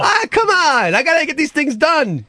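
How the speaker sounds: whiny protest. Real, not acted. Adam protesting being rushed.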